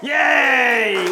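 loud, high whoop of delight; excited cheer. Real, not acted. Leader cheering the children's catch, loud excited whoop. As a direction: cheer as the fish is caught. Fish caught during children's talk.

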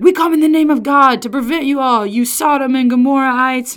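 loud, high doom-preacher proclamation; mock screaming. Street preacher denouncing students in God's name, mock screaming. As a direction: imitate a vitriolic campus preacher denouncing a crowd. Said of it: "screaming at folks".

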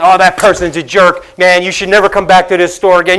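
loud scornful brush-off; a loud, dismissive store worker; mock rude shout. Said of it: "And not to say".

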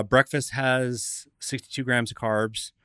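normal talking voice

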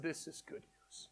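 slow, soft near-whisper; hushed reassurance. Retold or imitated. Grandpa soothing his frightened grandson, soft whisper. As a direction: breathe it gently, calming.

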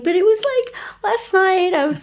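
high, wailing retelling of a bad night; mock tearful wail. [name] retells blubbering to Dwayne, high tearful wail. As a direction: pitch way up like a weepy mess.

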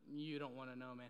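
mock confidential. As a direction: drop it low, lightly imitating the salesman.

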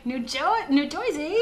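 high, laughing mock Joisey; New Jersey accent. Shown as a demonstration. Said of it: "New Jersey".

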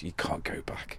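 a quick whispered aside; whispering. Real, not acted. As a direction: drop to a whisper for the punchline. Aside about coffee makers.

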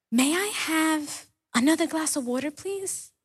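preacher voicing a small boy who asks for water again; small child's voice. Preacher voicing a thirsty little boy calling from bed, small and pleading. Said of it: "a small voice sounded through the house".

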